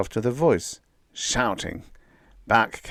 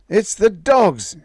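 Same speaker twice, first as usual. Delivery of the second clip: loud, higher, slow shout; yell; 12 semitones higher, 9 dB louder, slower than usual. A narrator's reading. Bill yelling to Molly as he runs. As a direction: yell it across to her while running. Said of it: "he yelled at Molly".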